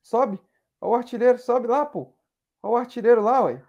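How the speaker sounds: egging the striker on; calling out excitedly. Real, not acted. Cheering on the striker on screen.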